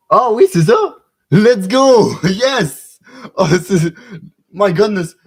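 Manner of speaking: loud, high burst of excitement; exclamation emballée. Real, not acted. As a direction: shout it out like a sudden win. Comprend soudain et s'emballe. (cris de joie forts et aigus)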